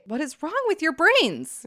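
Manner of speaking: high, loud outburst; exasperated shout. Real, not acted. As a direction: yell it in disbelief at the colonizers. Shouting at colonizers.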